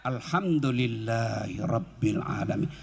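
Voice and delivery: low, slow and soft; slow heartfelt praise. Shown as a demonstration. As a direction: savour each word gratefully. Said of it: "ucapkan dengan lapang dada".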